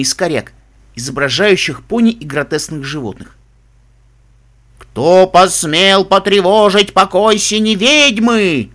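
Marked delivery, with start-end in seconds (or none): 4.8-8.7 slow, loud, ominous spirit voice; booming echoing voice. Acted. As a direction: boom it out like an unseen witch. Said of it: "раздался гулкий голос, идущий словно со всех сторон". (гулкий зловещий голос отовсюду)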